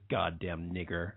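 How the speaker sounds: low, slow imitation of a contemptuous man; imitated muttered slur. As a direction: retell it low and drawn out. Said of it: "like that. I heard him say it".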